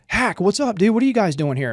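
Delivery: retold excited hail; animated greeting. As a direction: greet a buddy with surprise, mildly acted. Acted greeting from Apache pilots.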